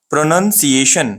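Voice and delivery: "प्रोनंसिएशन" slowly, second syllable "nun"; correct pronunciation. Shown as a demonstration. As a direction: model "प्रोनंसिएशन" as pruh-nun-see-ay-shun. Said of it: "कैसा पढ़ना है". ("प्रोनंसिएशन" धीरे, "नन" के साथ बोलें)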